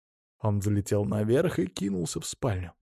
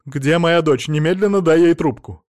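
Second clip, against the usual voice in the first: loud, raised, insistent voice; angry demand; 4 semitones higher, 6 dB louder than usual. A narrator's reading. Mother-in-law demands to speak to her daughter, angry order. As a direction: order him to hand over the phone.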